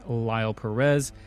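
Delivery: usual speaking voice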